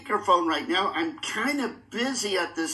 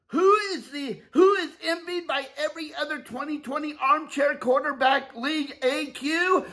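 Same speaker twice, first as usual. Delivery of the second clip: rising hype announcer build-up; 7 semitones higher than usual. Pretend. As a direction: build the crowd up before the call.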